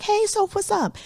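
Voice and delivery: breezy greeting, lifted high; lightly voiced friend. Friends greeting her outside class, a light, high hello. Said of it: "we would be like".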